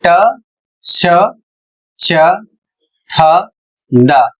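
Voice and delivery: each sound of T said slowly and clearly, ट, श, च, थ, द. Shown as a demonstration. Teacher lists the sounds of the letter T, carefully sounded. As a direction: sound out ट श च थ द one by one. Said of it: "टी के उच्चारण होते हैं".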